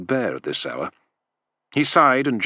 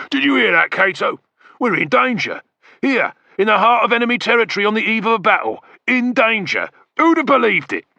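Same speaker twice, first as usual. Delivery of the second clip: much higher, louder mock alarm; sarcastic mockery; 11 semitones higher, 5 dB louder than usual. A narrator's reading. Macro mocking the spy's warning, sarcastic. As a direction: ham up the fake shock to mock him.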